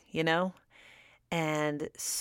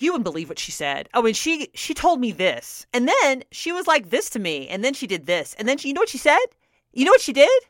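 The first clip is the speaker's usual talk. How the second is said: fast gossip that climbs higher and louder; building rant; 7 semitones higher, 5 dB louder, faster than usual. Retold or imitated. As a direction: pile it on, working yourself up. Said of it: "I was just talking like that".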